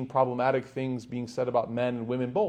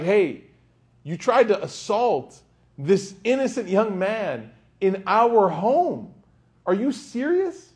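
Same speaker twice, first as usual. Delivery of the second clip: rising, louder, slower outraged rebuke; indignant accusation; 6 semitones higher, 4 dB louder, slower than usual. Retold or imitated. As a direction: scold the guest as if shocked he'd dare. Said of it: "instead of her being like".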